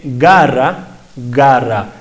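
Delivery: "garra" slowly with the G fully stopped. Shown as a demonstration. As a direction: close the throat hard on the G of "garra". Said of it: "El aire está antes de la vocal, está obstruido". ("garra" con la G oclusiva)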